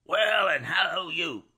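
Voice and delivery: a hoarse, high call back; hoarse shout. A narrator's reading. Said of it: "said Jerry more hoarsely than before".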